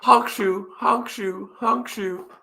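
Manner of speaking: high cartoon snore, honk shoe; mock snoring. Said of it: "I'm going to sleep".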